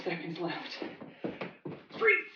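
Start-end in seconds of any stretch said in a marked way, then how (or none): 2.0-2.3 high freeze call. Shown as a demonstration. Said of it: "coach calling freeze cue".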